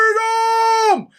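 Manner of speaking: high, drawn-out heroic yell; battle cry. Retold or imitated. Retelling Wallace's big moment, long high battle cry. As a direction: imitate a hero's last defiant shout. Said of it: "that's when he's like".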